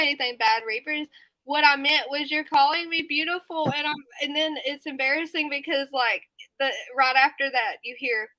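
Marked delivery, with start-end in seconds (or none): none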